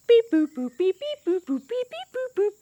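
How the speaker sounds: high sing-song beeps; phone dial beeps. Acted. Teacher to kids, caterpillar dials doctor, high beeps. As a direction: beep out the dialing.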